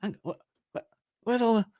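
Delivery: high, rising bewildered voice; high-pitched baffled cry; confused high yelp. Retold or imitated. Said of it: "and he goes".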